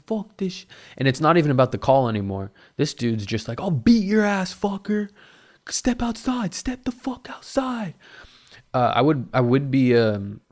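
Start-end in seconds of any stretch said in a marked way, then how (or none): 3.6-6.5 mock challenge to fight. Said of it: "this dude was yelling". 6.5-7.9 high, slow hissed threat. Retold or imitated. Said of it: "this dude was yelling".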